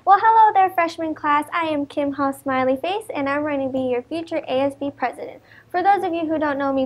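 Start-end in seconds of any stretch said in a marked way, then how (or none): none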